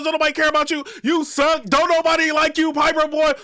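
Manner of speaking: high, loud mocking voice reading out taunts; mocking Briz. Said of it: "and Briz like".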